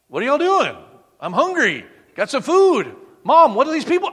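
high, loud, confused questions; bewildered risen son. Acted. The risen son asks his mom about the crowd, loud bewilderment. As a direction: wake up baffled and loud.

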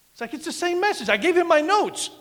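high, aggrieved comic protest; mock whining pastor. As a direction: whine as the slighted pastor.

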